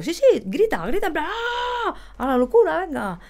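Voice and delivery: high, excited egging-on; urging a scream. Retold or imitated. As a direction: goad a shy student into screaming. Urges timid students to scream.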